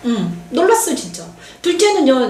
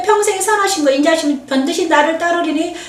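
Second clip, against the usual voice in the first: higher, solemn scripture chant; psalm recitation; 4 semitones higher than usual. Acted. Preacher reciting Psalm 23 to the congregation, solemn intoning.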